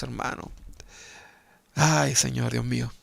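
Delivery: ordinary talk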